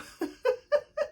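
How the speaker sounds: a breathy laugh pitched high; high giggle. Real, not acted. To the viewers after asking why she's so happy, a high giggle. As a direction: let a giggle trail off.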